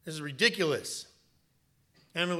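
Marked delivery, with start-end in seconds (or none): 0.0-1.1 loud, acted grievance; hammed-up indignant griping; mock complaining. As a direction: complain loudly, as the regretful recruit. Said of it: "come back to my office complaining".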